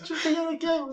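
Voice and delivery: very high squeak, a touch worried; squeaky worried voice. Retold or imitated. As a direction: fret in a tiny high voice. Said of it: "y dice". (voz aguda y preocupada)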